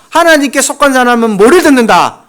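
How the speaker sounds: ordinary talk, nothing marked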